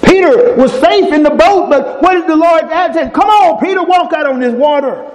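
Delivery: high, ringing preacher voice acting out Jesus and Peter; preaching at full force. Preacher retelling Peter on the water, fervent and high.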